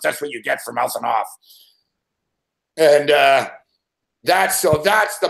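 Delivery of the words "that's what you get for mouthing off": gloating cop. Retold or imitated. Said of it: "Lesnar's father stuck his head".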